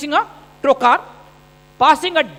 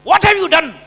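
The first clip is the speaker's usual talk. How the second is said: a mock angry demand, higher and louder; wife's angry question; 5 semitones higher, 5 dB louder than usual. Wife confronting surgeon husband, mock angry higher voice. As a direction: play the wife snapping at her husband. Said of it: "Suddenly she comes to me".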